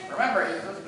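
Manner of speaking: usual speaking voice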